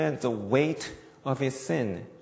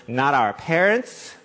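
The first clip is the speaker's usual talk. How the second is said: ordinary talk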